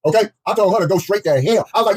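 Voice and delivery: ordinary delivery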